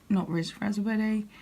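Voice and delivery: normal talking voice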